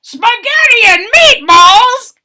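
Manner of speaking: high loud crowd shout; the whole crowd shouting it out; loud high group cry. A narrator's reading. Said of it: "shouted the people".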